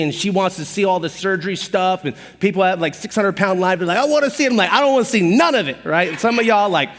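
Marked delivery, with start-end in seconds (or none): 4.0-4.5 eager wife. Retold or imitated. Wife eager for surgery shows. 4.7-5.8 disgusted refusal. Retold or imitated. Disgusted refusal of gross videos.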